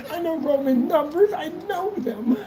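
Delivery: high, whiny mock sob of a wounded critic; mock whiny protest. Mocking critics in a high whiny crybaby voice.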